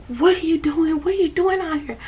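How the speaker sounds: high, urgent retold questioning; alarmed rescuer. Sister's friend to the child she just yanked out, high alarmed questioning. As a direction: imitate a startled rescuer, pitched well up.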